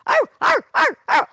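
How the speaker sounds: short, high, loud barks; punchy high-pitched yaps; dog bark. Shown as a demonstration. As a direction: bark like a small dog in quick even bursts. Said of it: "hiss, bark".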